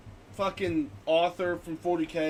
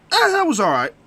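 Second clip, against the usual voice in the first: high, loud, noncommittal shrug; mock shrug; 10 semitones higher, 7 dB louder than usual. Reader judging the weakest book by a favorite author, mock shrug. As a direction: give a so-so verdict in a put-on voice. Acted lukewarm reader's verdict.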